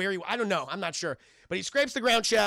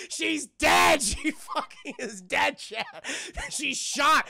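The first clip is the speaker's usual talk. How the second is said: laughing; 7 semitones higher, 4 dB louder, much slower than usual. Real, not acted. Laughing disbelief at movie scene.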